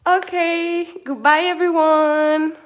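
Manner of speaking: big, loud, slow farewell; loud goodbye. Real, not acted. Teacher signing off her live stream to everyone, loud slow cheerful goodbye. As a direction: call out goodbye to everyone, drawn out.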